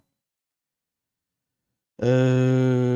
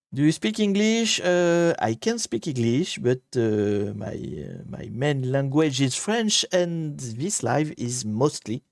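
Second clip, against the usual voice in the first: halting English with a thick French accent; real French accent in English, drawn-out words; slower than usual. Real, not acted. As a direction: speak slow, careful English as a native French speaker. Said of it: "avec mon accent de merde".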